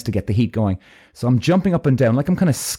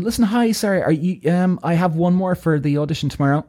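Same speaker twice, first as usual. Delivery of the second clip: higher-pitched receptionist making a call; pitched-up, apologetic phone manner; 6 semitones higher than usual. Retold or imitated. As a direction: imitate a polite woman ringing a colleague. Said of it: "so she's on to them".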